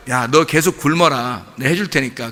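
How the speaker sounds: lightly voiced mother, low and unhurried; low, even parental dismissal; mother's retort. Mother to child refusing food, mother's low retort. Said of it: "엄마가 뭐라 그래요".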